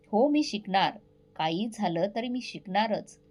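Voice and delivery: plain reading voice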